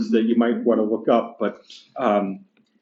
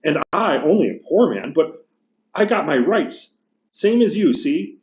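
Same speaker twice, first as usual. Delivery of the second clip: higher, indignant working-class ghost; gruff big ghost; 6 semitones higher than usual. Acted. Teacher reading the ghost complaining to the bright spirit, indignant. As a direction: bluster like an aggrieved ghost. Ghost arguing for his rights.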